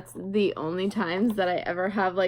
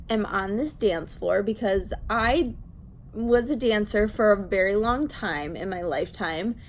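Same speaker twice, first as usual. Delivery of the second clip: usual speaking voice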